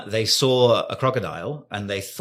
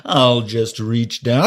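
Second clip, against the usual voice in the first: greedy inner voice; 3 semitones higher, 7 dB louder than usual. Pretend. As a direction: play the greedy hunter eyeing eggs. Imagined hungry egg thief.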